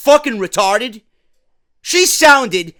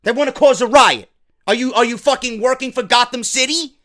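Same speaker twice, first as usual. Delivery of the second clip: ordinary talk, nothing marked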